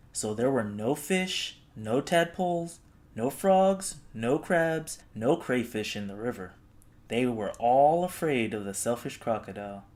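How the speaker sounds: ordinary delivery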